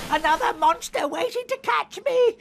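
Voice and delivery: panicking, nearly crying; frightened. A narrator's reading. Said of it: "squeaked Little Mouse".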